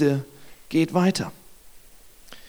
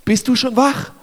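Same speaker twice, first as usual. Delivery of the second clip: higher-pitched, exasperated disbelief; incredulous servant; 4 semitones higher than usual. Acted. Servant doubting Elisa has looked outside, exasperated disbelief. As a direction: challenge him as if he's missed the obvious. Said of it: "wahrscheinlich denkt der Diener in diesem Moment".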